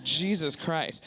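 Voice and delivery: usual speaking voice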